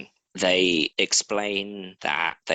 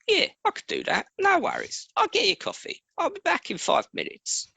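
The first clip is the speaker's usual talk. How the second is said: far higher, fast, falsetto-like mock officer; faster than usual. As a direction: play the sly officer in a much higher, hammed-up voice. Officer offers coffee, voiced mockingly.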